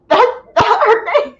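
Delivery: high, mock disbelieving question; mock incredulous. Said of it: "and I'm like".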